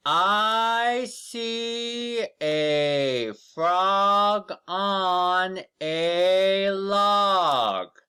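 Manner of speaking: very slow, high and loud, each word stretched; slow model reading. Shown as a demonstration. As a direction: read it out slowly for children to copy. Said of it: "Let's read it again".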